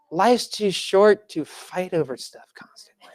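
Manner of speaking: slow, breathy whispered aside; hushed slow confidence. Acted. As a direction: lean in and whisper it. Sermon point dropped to whisper.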